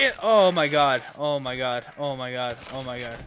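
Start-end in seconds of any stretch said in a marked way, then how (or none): none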